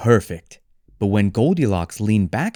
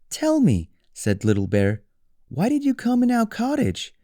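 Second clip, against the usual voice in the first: high cub voice; little bear; 12 semitones higher than usual. Acted. Little Bear asks Goldilocks why she came, high. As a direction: ask like a curious small cub.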